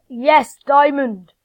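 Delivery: loud, slow, delighted yes; excited cheer. Real, not acted. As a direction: cheer over a find. Finds a diamond.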